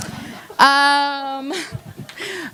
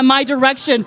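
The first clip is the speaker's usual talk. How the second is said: usual speaking voice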